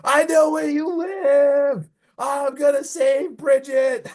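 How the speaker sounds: long, drawn-out high mock scream; slow, high-pitched mock battle cry; imitated screaming. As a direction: stretch each word into a hammed-up heroic yell. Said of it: "He's, like, screaming".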